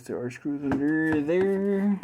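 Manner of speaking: ordinary delivery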